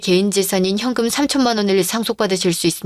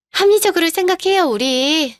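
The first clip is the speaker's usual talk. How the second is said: condescending coax; 6 semitones higher than usual. Acted. Mistress pushes divorce.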